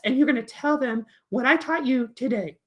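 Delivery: high, bossy puppet voice; Peter. Acted. Puppet coaches kindergarten against other voices, high and insistent. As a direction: lecture in a high, excitable character voice. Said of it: "and then Peter says".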